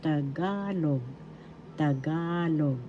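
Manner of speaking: Tagalog. said slowly, leaning long on "ga"; teacher slowly modelling Tagalog. with stress on GA; stress on second syllable. Shown as a demonstration. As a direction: stretch the middle of Tagalog.: ta-GAA-log. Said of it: "that's where the accent is".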